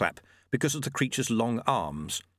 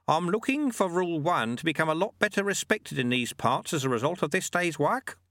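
put-on raised, calm old sweeper; old monk voice; 7 semitones higher than usual. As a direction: voice the sly old monk lightly. Narrator voices Lutze.

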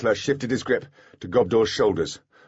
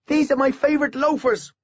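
put-on child voice; 3 dB louder than usual. A narrator's reading. Miles child voice continues.

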